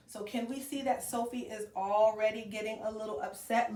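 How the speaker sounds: ordinary talk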